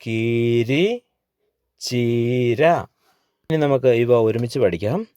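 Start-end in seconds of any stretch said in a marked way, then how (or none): 0.1-2.7 കീരി, ചീര with long, held ee; long-vowel reading drill. Shown as a demonstration. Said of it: "ഇതുകൊണ്ടുള്ളൊരു വാക്ക് നോക്കൂ". (കീരി, ചീര ഈ നീട്ടി വായിക്കുക)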